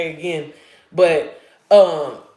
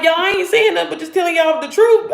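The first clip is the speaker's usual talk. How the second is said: high and loud, excited; answering fans who message her, excited; excited reply; 9 semitones higher, 4 dB louder than usual. Retold or imitated. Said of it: "and I'm like".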